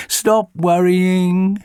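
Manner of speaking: slow, soothing reassurance; calm the worried boy slowly. A narrator's reading. Jenny reassures Charlie, slow and soothing.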